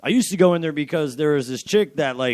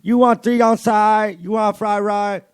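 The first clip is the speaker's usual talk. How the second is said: higher, slow imitated Chinese accent; hammed-up Chinese accent, drawn out; 7 semitones higher, 8 dB louder, slower than usual. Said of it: "your Chinese accent".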